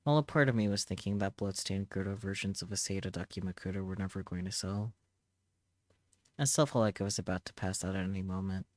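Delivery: usual speaking voice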